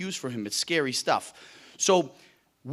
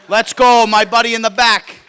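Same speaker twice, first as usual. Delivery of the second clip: loud call to the back of the room; 6 semitones higher, 15 dB louder than usual. Real, not acted. Speaker calling to his buddy at the back, loud.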